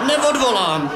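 high defiant shout; stubborn kid yelling no; defiant boy. Acted. The boy refusing while held.

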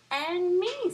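high, loud little flea; a tiny flea chiming in. Acted. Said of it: "said the flea".

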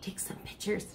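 soft unvoiced whisper. A narrator's reading. Aunt urging photos near the birds, whispered. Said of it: "she whispers".

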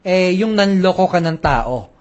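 usual speaking voice

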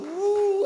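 loud, high, long "woo"; imitated spinning whir. Seeker spinning with God, loud high whoop. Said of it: "Spin with me".

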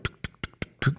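soft unvoiced keyboard clicks; quiet mimicked key taps; imitated typing. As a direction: tick your tongue like someone tapping at a computer. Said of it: "the guy's on the computer".

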